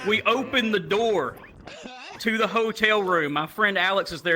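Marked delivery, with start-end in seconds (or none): none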